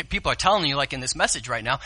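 normal talking voice

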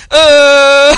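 a toddler's pretend cry; father imitating his daughter's fake cry. Shown as a demonstration. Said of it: "'cause it's a fake cry, it sounds like this".

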